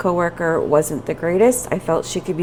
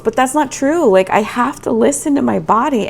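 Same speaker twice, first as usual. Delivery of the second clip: higher, louder insistence; emphatic; 6 semitones higher, 5 dB louder than usual. Real, not acted. Vlogger arguing with herself about her body, emphatic. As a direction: insist on it, frustrated.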